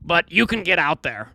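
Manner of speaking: put-on reading a quote in a high Brooklyn accent. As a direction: raise the pitch, thick Brooklyn accent. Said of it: "little Brooklyn accent".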